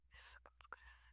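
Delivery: breathy hushed "Deixa o seu cabelo crescer", no voice at all; whisper. Retold or imitated. Echoing the Lord's words to herself in a whisper. Repeats God's words softly.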